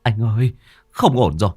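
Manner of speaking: breathless from running, words tumbling out; out of breath and frantic; panting and panicked. Acted. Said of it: "nó nói xen lẫn trong tiếng phì phò".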